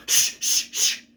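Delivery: forceful repeated "shh"; loud shushing. Acted. Shushing whispering pupils at school, loud shushes. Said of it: "That's louder than what we were doing".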